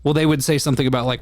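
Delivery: ordinary delivery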